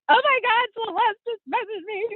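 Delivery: high, giddy squeal of excitement; fangirling. Retold or imitated. Wife retelling husband's fangirl reaction, squealing high. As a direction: gush like a starstruck fan. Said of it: "he's totally fangirling".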